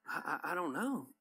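soft, halting mock evasion; evasive stammering. Gossiper dodging whether they went to them, evasive stammering. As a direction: stumble quietly over the first word.

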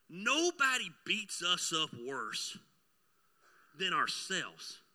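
slow, weighty preacher's line; slow emphasis. Acted. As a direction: drag each word out to land it.

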